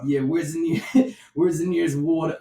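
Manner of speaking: loud, high gasping tourist; mock tired tourist. Cohost mocking tourists worn out from walking, high and loud. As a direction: whine like a worn-out walker.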